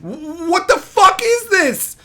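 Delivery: slow, high, incredulous outburst; can't believe what you're reading; stunned disbelief. Real, not acted. Ranting about the story, slow stunned disbelief.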